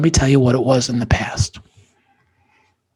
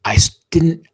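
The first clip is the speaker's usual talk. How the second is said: ordinary talk, nothing marked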